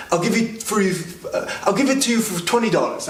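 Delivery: high-pitched, hurried character voice; haggling old shopkeeper. Retold or imitated. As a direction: imitate a shopkeeper urgently making an offer. Old shopkeeper haggling as customer leaves.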